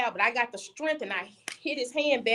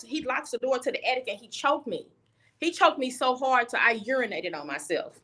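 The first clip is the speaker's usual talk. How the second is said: normal talking voice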